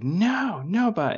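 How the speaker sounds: very high mimicked toddler refusal; high-pitched mock tantrum whine; protesting toddler. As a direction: imitate a small child refusing to leave. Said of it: "He's like".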